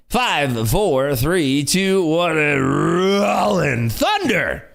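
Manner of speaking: high, loud countdown into a booming reveal; hyped announcer. Pretend. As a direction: draw out the winner's name like a ring announcer. Counts down, announces giveaway winner.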